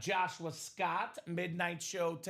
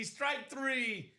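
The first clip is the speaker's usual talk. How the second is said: a higher, lighter rerun of the umpire call; umpire strike call; 6 semitones higher than usual. Shown as a demonstration. Repeating his umpire call for the co-host, lighter. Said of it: "That was my strikeout call".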